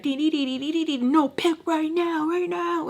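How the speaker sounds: high, urgent stream of mock alarm; anxiety voice. Anxiety demanding she pick right now, mock-panicked. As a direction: pitch it up and press insistently, like alarm bells. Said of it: "anxiety is like".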